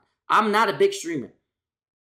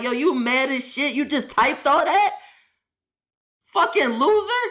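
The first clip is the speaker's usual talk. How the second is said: rising higher into a squeaky mock shout on "Fucking loser"; mocking all-caps Zoe; 6 semitones higher than usual. Streamer mocks Zoe's all-caps text message, higher and whiny. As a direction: read her caps-lock message as a whiny, high-pitched taunt. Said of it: "Then she typing in all caps".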